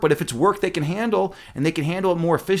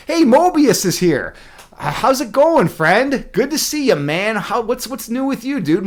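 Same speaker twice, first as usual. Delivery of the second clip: higher, louder, happy hello to a friend; light up as a buddy arrives; a bright, eager shout-out; excited greeting; 6 semitones higher, 4 dB louder, slower than usual. Real, not acted. Greeting a viewer who arrived.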